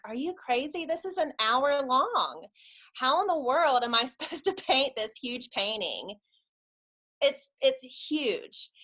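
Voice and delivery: high, exasperated disbelief; acted-out panicky objection; incredulous protest. Acted protest at huge painting.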